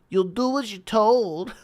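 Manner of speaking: high, slow put-on Irish accent; Conor McGregor accent. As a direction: stretch it out in a mock Irish accent. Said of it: "do certain phrases in different".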